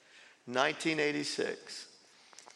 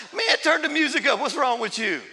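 higher and louder, imitating stoned friends; mock shout; 9 semitones higher, 6 dB louder than usual. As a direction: bellow it like an incredulous buddy.

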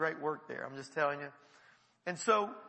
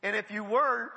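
ordinary delivery

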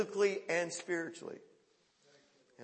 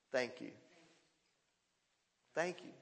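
soft, hushed thank you to the Father; 4 semitones lower, 8 dB quieter than usual. Retold or imitated. Preacher voicing Jesus thanking his Father, hushed. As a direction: breathe the thanks quietly. Quiet prayer voiced.

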